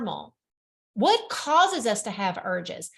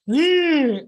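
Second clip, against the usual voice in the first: Bigfoot growl; 5 semitones higher, 9 dB louder than usual. Pretend. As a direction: growl it like a lurking beast. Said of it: "come out of nowhere and be like".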